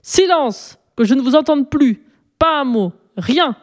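high, sharp yell for silence; furious shout. A narrator's reading. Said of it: "a crié le bouillon".

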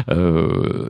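normal talking voice

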